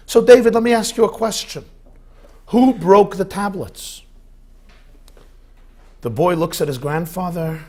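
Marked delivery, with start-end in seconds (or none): 0.1-1.7 voiced a bit higher and louder, quick; a stern grandpa pressing his grandson; hammed-up grandpa quiz; grandfather asking. Grandfather questions grandson in joke. 2.5-4.0 louder and higher, slow and deliberate; first asking, firm but not yet angry; grandfather's question. Acted.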